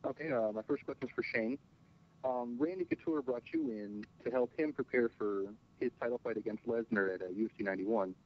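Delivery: on speakerphone. Real, not acted. Reporter on the conference line.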